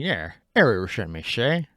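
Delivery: deliberately bad Chicago gangster accent. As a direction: play a cheesy Chicago mobster. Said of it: "in a really bad Chicago gangster accent".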